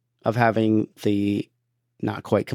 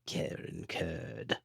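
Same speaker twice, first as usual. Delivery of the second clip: whispered, very slow, much softer evil Emperor; 4 semitones lower, 15 dB quieter, much slower than usual. Acted. Cohost whispering a patron name as the Emperor. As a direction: breathe the name like a dark lord. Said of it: "Let's do it in Emperor voice".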